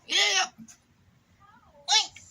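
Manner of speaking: high, loud squeaky hiccup. Acted. Piglet hiccuping while asking to help.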